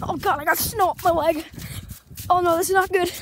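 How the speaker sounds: yelping up high and loud; alarmed outcry. Real, not acted. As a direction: cry out as snow hits your leg. Snow up leg, freezing.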